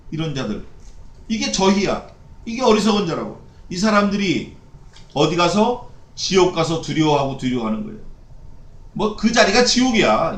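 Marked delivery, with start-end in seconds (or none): none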